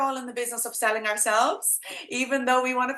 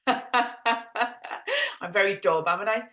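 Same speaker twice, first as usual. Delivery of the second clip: thick Dublin accent; 2 semitones lower, much slower than usual. Shown as a demonstration. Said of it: "my thick dub accent".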